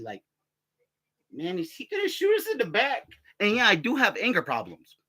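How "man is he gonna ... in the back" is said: imitating wary people. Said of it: "people go ahead and look at me funny".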